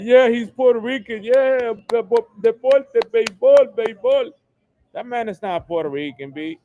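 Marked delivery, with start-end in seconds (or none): 0.0-4.3 higher, louder mocking voice of a fan; raised mocking voice, hammed-up; mock fan talk. People praising a baseball player, mocked in a higher, louder voice. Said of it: "Thinking that".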